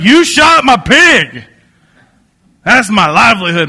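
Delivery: loud, high, hammed-up angry yell; imitating furious outrage. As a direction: bellow the outraged accusation, playing it up. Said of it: "guy was furious".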